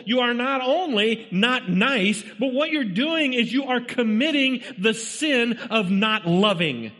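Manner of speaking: high-pitched, driving sermon delivery; urgent pulpit exhortation; impassioned preaching. Real, not acted. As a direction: preach it hard, pressing each point on the congregation. Preaches at full force.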